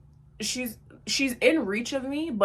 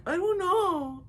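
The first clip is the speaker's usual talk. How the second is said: higher small meek voice; mock timid girl; 10 semitones higher, slower than usual. The girl answering the narrator timidly. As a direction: play her scared little answer.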